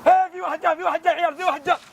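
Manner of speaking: high, urgent alert; excited shout. Acted. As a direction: call out that someone's coming. Spots someone coming, alerts friends.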